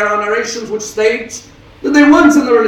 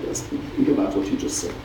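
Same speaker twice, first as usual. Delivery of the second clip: hushed urging; 13 semitones lower, 9 dB quieter than usual. Acted. Son softly urging his mother to think, whispered. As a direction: whisper it, soft and low.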